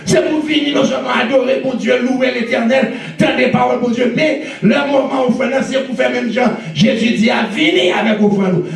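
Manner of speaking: sermon crié, voix haute et forte; prêche enflammé. Acted. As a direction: exhorte l'église à pleine voix, en montant. Preaching at full force. (prédication à plein volume)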